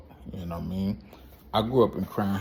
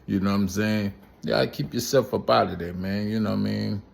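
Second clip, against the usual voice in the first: ordinary talk, nothing marked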